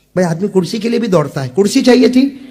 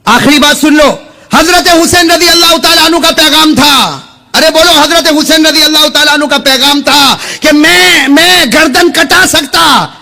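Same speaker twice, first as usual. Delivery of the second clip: raised, high-pitched proclamation; fiery preaching; 8 semitones higher, 7 dB louder than usual. Acted. Preacher giving the congregation Husain's last message, fiery preaching. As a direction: drive the message home in a high, ringing shout.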